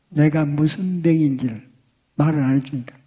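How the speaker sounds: normal talking voice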